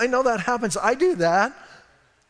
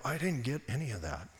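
very soft, low admission; sheepish undertone; 6 semitones lower, 12 dB quieter than usual. Retold or imitated. Preacher admits to his partner he missed it, hushed. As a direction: confess it under your breath, lost.

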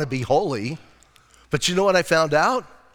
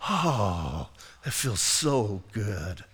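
relieved sigh; 8 semitones lower, 7 dB quieter, much slower than usual. Retold or imitated. Relief hearing Pastor Chuck tape.

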